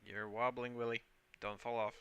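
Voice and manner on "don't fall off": normal talking voice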